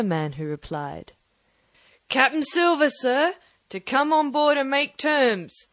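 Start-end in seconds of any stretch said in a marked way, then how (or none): 2.1-2.9 high announcing of the name; shouting the name. Acted. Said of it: "he shouted". 2.9-5.7 high, rough pirate herald; a pirate calling terms across the fence; shouted announcement. Acted. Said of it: "he shouted".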